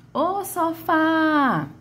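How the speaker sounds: high, very slow call of "ô sofá", FÁ held long; calling, stretched FÁ. Shown as a demonstration. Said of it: "Vamos chamar sofá?". (chamando "ô sofá", agudo, arrastando o FÁ)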